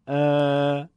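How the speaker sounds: ا held long and level; long alef. Shown as a demonstration. Teacher contrasting alef maddi with fatha, prolonged. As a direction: stretch ا to double length. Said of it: "دو برابر".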